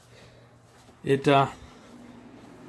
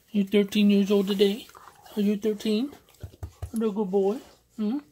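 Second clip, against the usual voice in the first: talking to a dog; 4 semitones higher than usual. Real, not acted.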